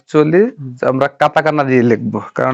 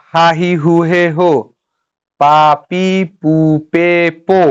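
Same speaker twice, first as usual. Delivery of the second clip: syllable-by-syllable drill with each vowel held; measured kana recitation; 2 semitones higher than usual. Shown as a demonstration. Teacher finding "po" in the kana rows, reciting syllable by syllable.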